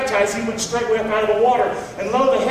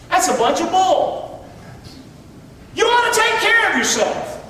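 emphatic preaching; 9 semitones higher than usual. Real, not acted. Pastor to congregation on self-care, raised high. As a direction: call it out with force. Preacher scolding false spirituality.

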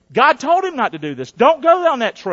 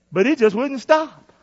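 ordinary delivery

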